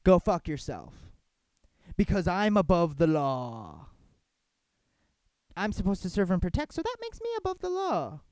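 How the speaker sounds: mock the cop's arrogance; sarcastic take on the cop brushing off the filmer.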